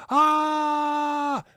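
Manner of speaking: high, loud falling scream; sudden high yell of someone falling; mock scream. Host acting out a man falling down a well, high scream.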